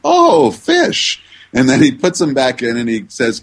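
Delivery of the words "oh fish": high-pitched, louder mock surprise at the fish; surprised guard. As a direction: squeak it out like the guard suddenly gets it. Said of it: "and he goes".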